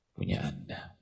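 usual speaking voice

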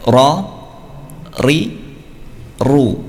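ro ri ru with a short two-beat trill; correct ra. Shown as a demonstration. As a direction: trill ro, ri, ru just twice. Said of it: "Yang betul adalah".

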